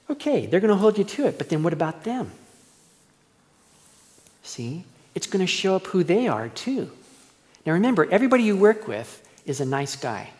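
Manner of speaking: ordinary talk, nothing marked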